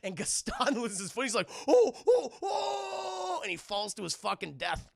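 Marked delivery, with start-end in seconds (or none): 1.7-3.4 very high wobbling cries of losing balance; mock falling yell. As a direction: wail as you topple, cartoonishly. Gaston falls to his death.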